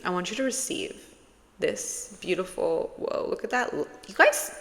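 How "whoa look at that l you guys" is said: high, fast, thrilled squeal; excited high voice. Real, not acted. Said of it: "Why am I talking like that?".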